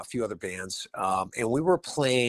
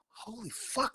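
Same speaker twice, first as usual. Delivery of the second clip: whispered amazement; 8 dB quieter, slower than usual. Retold or imitated. Retold amazement, Alice remembered him.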